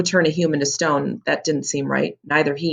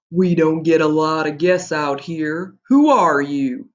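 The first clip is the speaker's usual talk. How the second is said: slow giant voice; 4 dB louder, slower than usual. Acted. Giant questions the boys.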